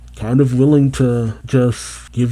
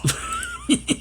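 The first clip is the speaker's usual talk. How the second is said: high burst of laughter; 7 dB quieter than usual. Real, not acted. Laughs at the blunt Yes.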